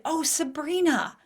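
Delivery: slow, lightly voiced sweet mom; a hint of a sweet mother, drawn out; sweet mother quote. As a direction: mimic mom gently reminding her. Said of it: "my sweet mother piped up and said".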